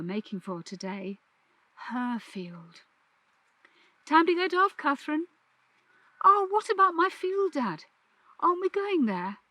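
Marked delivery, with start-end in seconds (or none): 4.0-5.3 calling out. Acted. Dad calls her off the bus. 6.2-9.4 put-on high, bright-eyed child asking her dad; eager girl. Catherine eagerly asks Dad about her field in a high child voice.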